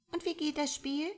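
put-on high, bright little-girl voice, curious. A narrator's reading. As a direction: ask like a small curious child, pitched high. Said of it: "Ein kleines Mädchen".

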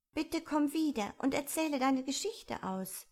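high-pitched pleading call; calling after. A narrator's reading. Alice calls after the departing mouse, pleading. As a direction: call after someone leaving, lightly projected. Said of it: "rief Alice ihr nach".

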